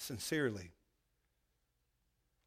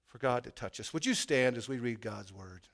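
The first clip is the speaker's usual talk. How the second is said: normal talking voice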